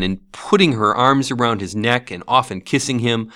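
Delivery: plain reading voice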